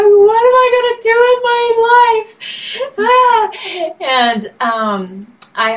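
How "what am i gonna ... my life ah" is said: high, loud, drawn-out mock wail; crying out. Singer retelling her breakdown to the universe, crying out. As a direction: wail it out melodramatically, high and sustained. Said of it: "started crying out to the universe".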